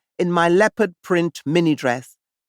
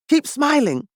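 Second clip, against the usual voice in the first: self-encouragement; 8 semitones higher than usual. Retold or imitated. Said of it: "I told myself".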